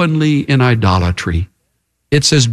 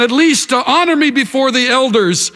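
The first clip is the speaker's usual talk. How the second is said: high-pitched plea of a desperate king; pleading; 11 semitones higher than usual. Acted. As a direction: beg in a much higher voice. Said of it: "Saul pleads again".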